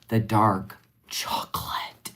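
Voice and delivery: slow unvoiced savoring whisper. Real, not acted. Whispering to viewers about the favorite flavor. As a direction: breathe the name slowly, almost a sob.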